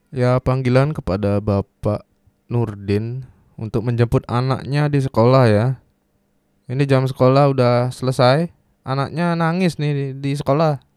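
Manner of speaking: mock school announcement. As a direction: page a parent over the school speaker.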